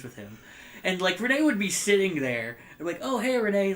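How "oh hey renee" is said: higher, louder teasing sing-song greeting; mock taunt. Teasing his grounded friend Renee, in a mock taunt. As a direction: rub it in with a cheeky hello.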